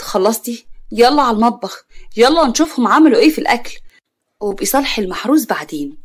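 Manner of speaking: ordinary delivery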